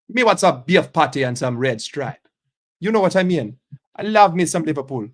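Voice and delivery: loud, put-on Jamaican accent. Streamer trying a fun accent, in loud Jamaican. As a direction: belt it out in a playful Jamaican lilt. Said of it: "Jamaican accent's fun".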